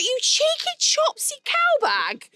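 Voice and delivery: very high, drawn-out mock outrage; indignant insult. Telling off an ungrateful photographer, comically outraged. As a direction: squawk it slowly, scandalised.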